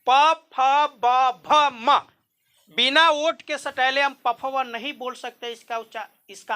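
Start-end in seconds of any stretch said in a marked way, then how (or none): 0.1-2.0 very slow, lips meeting on each letter; slow letter-by-letter demonstration; slow demo recitation. Shown as a demonstration. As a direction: sound out each letter slowly. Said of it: "अरे आप पढ़िए ना".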